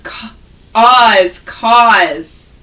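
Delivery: cause split into ca- and oz, then blended as cause; sounded out. Shown as a demonstration. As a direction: stretch the vowel in cause. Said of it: "we know this word".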